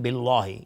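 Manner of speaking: mistaken بالله with a thick, dark L; wrong heavy L. Retold or imitated. Teacher imitating what some people say wrongly, heavy L in بالله. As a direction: imitate the error, darkening the L of بالله. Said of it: "این اشتباهه".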